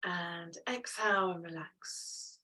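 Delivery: slow soft whisper. Real, not acted. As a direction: breathe the words out gently. Guiding release after held pose.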